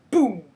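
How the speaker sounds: loud spoken explosion sound; loud mock boom. Spider-Woman hitting the bots, loud boom. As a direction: punch out the boom. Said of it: "Spider-Woman goes".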